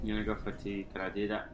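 hesitant quiet mumble; quiet unsure. Real, not acted. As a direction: say it under your breath, unsure. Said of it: "You, you, you seem whispering here".

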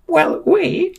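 very high screechy milk seller; piercing high character voice; Frau Milk. A narrator's reading. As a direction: squeal the offer in a very high voice. Frau Milk gives in and answers.

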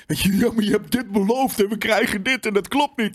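high, whiny imitation of an outraged fan; mocking the fans who are angry about broken promises; mock angry gamer. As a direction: mimic a petulant entitled gamer. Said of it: "Ik ben niet zo van".

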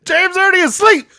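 high, loud squealed announcement; high mock announcement. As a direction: shriek it like a PA call.

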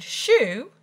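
high, loud shooing cry; shouted. A narrator's reading. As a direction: shout to scare it away. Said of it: "but when Bessie shouted".

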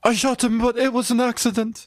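put-on high, pleading boy voice; high anxious voice. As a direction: confess it high and earnest. Stubbins quoted high.

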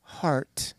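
breathy whispered punchline. Retold or imitated. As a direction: say it under the breath. Marian's coy punchline.

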